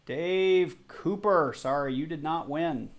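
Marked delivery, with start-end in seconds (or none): 0.0-1.5 slow, stretched announcer call; slow game-show name reveal; drawn-out reveal. Acted. Announcing the wheel's first loser.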